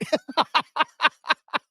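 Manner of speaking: a high burst of real laughter at a funny comment. Real, not acted. Host laughing at a viewer's joke in chat.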